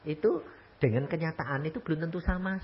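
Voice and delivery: ordinary talk, nothing marked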